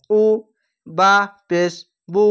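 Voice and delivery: ordinary talk, nothing marked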